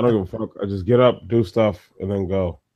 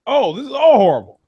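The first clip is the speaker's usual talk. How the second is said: disgusted mock whine; 9 semitones higher, 4 dB louder than usual. Friend mocking his first sip of coffee, hammed-up disgust. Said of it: "and I'm like".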